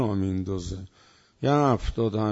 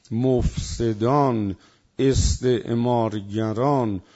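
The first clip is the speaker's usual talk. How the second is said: slow emphatic list; slower than usual. Real, not acted. Preacher to congregation, slow pounding list of enemies. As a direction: hammer every name in the list slowly.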